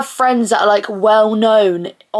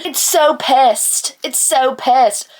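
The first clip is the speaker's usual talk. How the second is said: put-on American accent; 2 semitones higher, much slower than usual. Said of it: "my amazing American accent".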